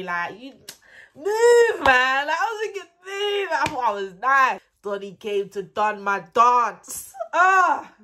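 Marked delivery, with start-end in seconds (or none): 1.1-3.5 high, loud brush-off; dismissive shout. Retold or imitated. Telling the date to move, high loud shout. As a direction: shout him away, much higher.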